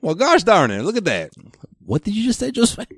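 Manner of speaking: high-pitched hokey Midwestern twang; playing a wide-eyed small-town yokel, pitch up high. Mocking folksy hick voice.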